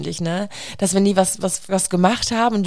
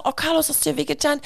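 concerned mother; 7 semitones higher, faster than usual. Retold or imitated. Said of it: "Ich sag".

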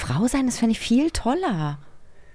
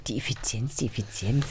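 mock deep voice; 9 semitones lower, 8 dB quieter than usual. Mocking the host's word.

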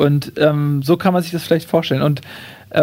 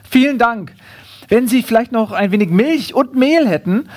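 higher, over-courteous borrower; politely angle for one more favour, pitched up; raised, courteous kangaroo voice; 5 semitones higher than usual. Acted. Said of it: "Das Känguru nimmt alles, bedankt sich".

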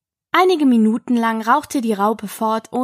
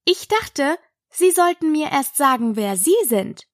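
higher-pitched, earnest Alice; earnest, insistent objection; very serious; 6 semitones higher than usual. A narrator's reading. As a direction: put it to the caterpillar seriously. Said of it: "sprach sehr ernst".

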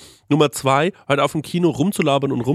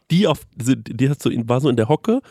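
usual speaking voice

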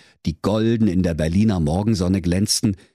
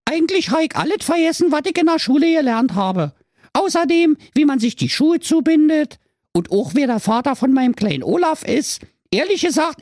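very high, louder, fast squeaky chatter; scatterbrained Trulla; 4 dB louder than usual. Acted. Trulla confessing to the doctor, high fast airhead chatter. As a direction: babble like a cheerful airhead. Trulla admits forgetting everything.

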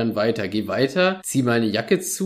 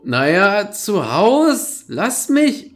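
higher, groggy brush-off; sleepy drunk; 7 semitones higher, 4 dB louder, much slower than usual. Retold or imitated. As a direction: wave him off half asleep. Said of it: "Und er so".

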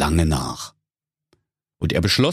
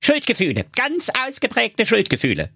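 owl doctor voice. A narrator's reading. Owl psychologist diagnoses.

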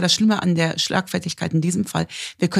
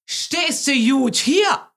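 mock bellow through the window; slow, drawn-out mock shout at a parked mother; retold yell; 5 semitones higher, much slower than usual. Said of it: "brüllt rein und sagt".

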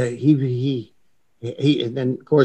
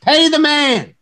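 triumphant call; 4 dB louder, slower than usual. Retold or imitated. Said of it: "I said".

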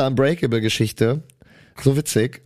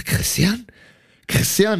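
calling a name, higher, to wake him; 6 semitones higher, slower than usual. Retold or imitated. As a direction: call him twice to rouse him. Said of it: "ich so". (ruft den Namen, höher, um ihn zu wecken)

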